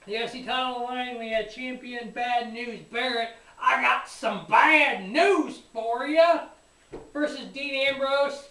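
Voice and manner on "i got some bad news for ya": slow, loud wrestler catchphrase, pitched up; catchphrase impression. Quoting Bad News Barrett's catchphrase.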